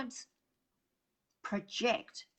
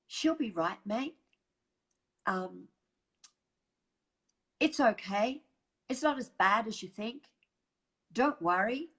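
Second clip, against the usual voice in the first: Australian brush-off; 3 semitones higher, 5 dB louder than usual. Shown as a demonstration. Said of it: "you hear the phrase".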